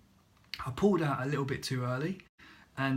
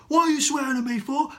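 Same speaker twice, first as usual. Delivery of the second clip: high, very loud outraged retort; indignant shouting; 11 semitones higher, 10 dB louder than usual. Retold or imitated. Instructor to swearing learner, indignant acted shout. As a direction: imitate an instructor yelling back, affronted. Instructor yelling back in the quarrel.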